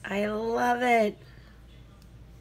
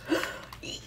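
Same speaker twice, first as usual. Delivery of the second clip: sharp high gasp of delight; surprised gasp; 7 dB quieter than usual. Real, not acted.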